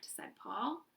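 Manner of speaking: plain reading voice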